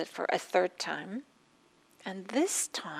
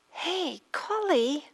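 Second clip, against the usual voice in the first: calling the maid; 9 semitones higher, 6 dB louder, much slower than usual. Retold or imitated. Mistress calling the maid sharply.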